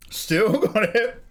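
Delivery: high, slowed, chuckling through it; laughing. Real, not acted. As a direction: laugh while saying it.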